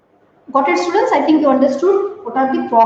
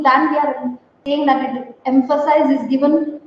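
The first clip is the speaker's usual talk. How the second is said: ordinary talk, nothing marked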